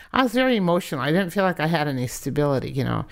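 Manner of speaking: normal talking voice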